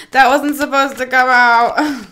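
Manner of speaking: loud, high exclaim of the slip; mock outburst. Tarot reader repeats her slip in a loud high blurt.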